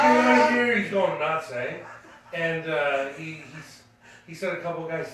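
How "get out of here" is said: much higher and louder, imitated yell; furious shout. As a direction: throw them out at the top of your voice. Ronnie throwing people out.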